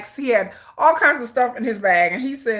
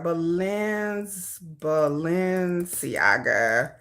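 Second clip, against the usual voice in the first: "Balenciaga" stretched out very slowly, lower; slow sounding-out; 5 semitones lower, 6 dB quieter, much slower than usual. Real, not acted. Typing a search she can't spell, "Balenciaga" slowly sounded out. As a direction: draw out each syllable of "Balenciaga".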